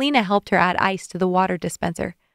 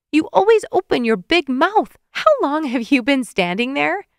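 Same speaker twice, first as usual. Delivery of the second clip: scolding wife; 7 semitones higher, 4 dB louder than usual. A narrator's reading. Wife scolding husband, embarrassed.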